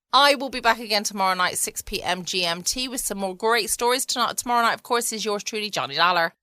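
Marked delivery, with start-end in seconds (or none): none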